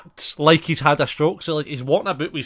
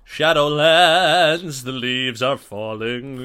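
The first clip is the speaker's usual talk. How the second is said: slow, drawn-out movie trailer voice; epic trailer voice; much slower than usual. Pretend. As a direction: intone it like a dramatic trailer.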